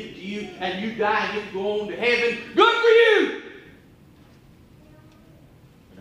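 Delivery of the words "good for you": much higher and louder, mock cheerful; sardonic high exclamation. Real, not acted. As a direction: toss it off with cheeky triumph. Preacher's sardonic punchline.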